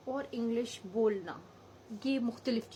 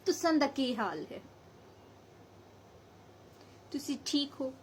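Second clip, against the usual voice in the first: Abbottabad Hindko accent; 3 semitones higher than usual. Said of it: "Abbottabad में चले जाते हैं".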